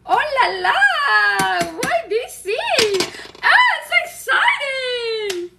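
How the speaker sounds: high, loud whoop with stretched vowels; excited exclaiming. Acted. Reacting to someone moving to B C, excited. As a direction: squeal with delight at the news. Acted delighted reaction to a move.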